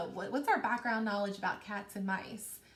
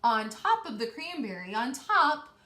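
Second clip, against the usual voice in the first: higher, building read-aloud voice; suspenseful story narration; 5 semitones higher, 4 dB louder than usual. A narrator's reading. Teacher reading the teetering tower to her class, building suspense.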